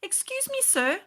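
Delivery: put-on high, shy child's voice; a small boy politely interrupting. Tristan politely asks the bubble man, high child voice. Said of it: "Tristan said".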